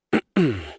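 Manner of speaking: a short, deliberate throat clear before the punchline; theatrical throat clearing. Acted. About to tell the traveler a joke, a mock-formal throat clear.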